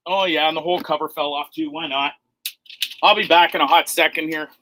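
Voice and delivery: ordinary delivery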